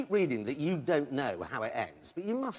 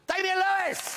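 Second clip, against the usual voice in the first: big rising announcer call; announcer intro; 5 dB louder, slower than usual. Real, not acted. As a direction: belt the guest's name like a showman. Host introducing guest, announcer.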